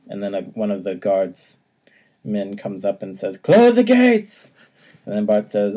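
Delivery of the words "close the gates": high, slow, loud yell of a guard; shouted order. Acted. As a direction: bellow the order to shut the gates. Guard shouting in retold scene.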